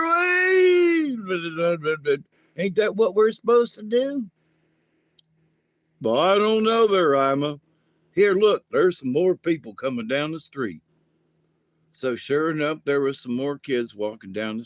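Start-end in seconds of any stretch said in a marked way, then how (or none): 0.0-2.2 long high stretched ween; hollered ween. Acted. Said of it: "he said holler". 2.6-10.8 high alien voice. Acted. Yura replies.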